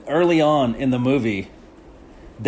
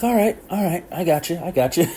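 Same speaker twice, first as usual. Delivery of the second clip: higher, easygoing "all right" in another voice; placating cop; 5 semitones higher, faster than usual. Retold or imitated. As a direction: brush off the refusal with a higher, knowing tone. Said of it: "and so he's like".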